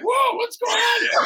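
very high, thrown-up startled cry; father shocked as the tables turn on him; mock startled whoa. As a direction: sound caught off guard by a stranger. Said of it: "usually I'm the one".

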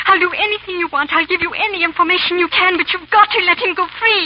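high, loud, frantic begging; desperate pleading. Acted. Lady Blakeney begging Chauvelin to free her husband, desperate. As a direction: beg for his life with everything.

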